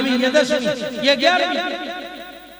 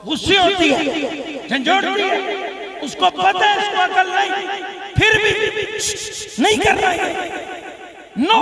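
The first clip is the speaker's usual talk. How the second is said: higher-pitched, emphatic mock scolding; acted scolding mother; 7 semitones higher than usual. Mother warning her toddler off danger, acted scolding. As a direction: scold as a worried mother would.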